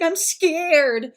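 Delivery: high, loud imitation of a frightened kid; scared child. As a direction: mimic a little boy admitting fear. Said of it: "at one point he's like".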